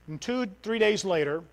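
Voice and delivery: ordinary talk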